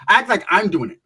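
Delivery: ordinary talk, nothing marked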